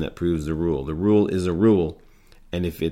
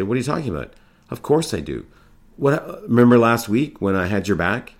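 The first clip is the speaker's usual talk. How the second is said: higher, rising to louder protest; defensive denial; 5 semitones higher, 5 dB louder than usual. Acted. Character B denies the accusation of character A, defensive and rising. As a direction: push back, offended and defensive.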